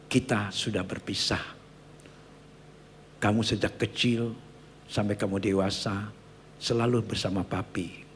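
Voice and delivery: low, soft, measured recital; solemn letter reading. Retold or imitated. Father writing to his child about parting, subdued solemn reading. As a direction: recall a father's farewell, hushed and low.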